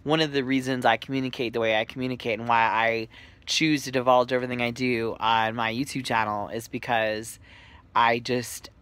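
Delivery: ordinary talk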